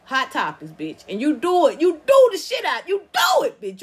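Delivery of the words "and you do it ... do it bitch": loud, high excited praise, nearly shouting; excited hype. Real, not acted. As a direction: cheer her on like a fan in the crowd. Hyping up Wendy as a legend.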